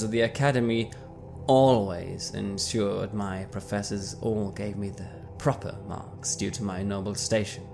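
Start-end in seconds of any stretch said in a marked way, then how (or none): none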